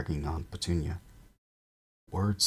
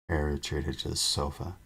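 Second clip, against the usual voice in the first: ordinary talk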